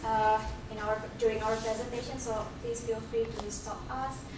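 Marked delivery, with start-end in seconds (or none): none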